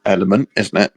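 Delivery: normal talking voice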